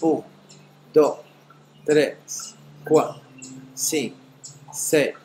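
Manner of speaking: voz suave y pausada de meditación; guía el regreso con calma; meditación guiada. Acted. Closes the guided meditation. (voz serena de meditación)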